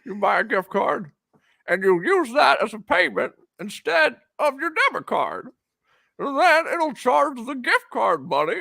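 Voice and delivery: put-on stammery old-movie Jimmy Stewart; Jimmy Stewart impression. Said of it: "go back to Jimmy Stewart?".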